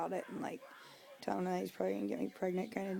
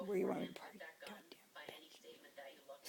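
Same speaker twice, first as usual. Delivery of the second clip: slow, softer whispered curse under the breath; hushed insult; 7 dB quieter than usual. Real, not acted. Venting about the sister-in-law in a careful whisper. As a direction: whisper it so the neighbors won't hear. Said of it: "so I gotta be careful".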